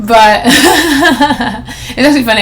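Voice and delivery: chuckling through the words, slowed down; laughing. Real, not acted. Vlogger amused at her fake-Texan plan, laughing. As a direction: let the laugh spill into the line.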